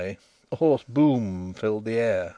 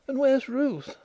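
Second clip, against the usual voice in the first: put-on very high girlish questioning voice; girl's question; 13 semitones higher than usual. A narrator's reading. Nellie asking Denry about Ruth, high girlish voice. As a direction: pitch it well up for the girl.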